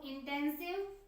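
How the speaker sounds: "Intensive speech practice" spaced out word by word, slow and soft; slow dictation. Shown as a demonstration. Teacher dictating "intensive speech practice" to the class, slow and soft. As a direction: spell out "intensive speech practice" slowly for students to write down. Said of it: "लिख सकते हैं".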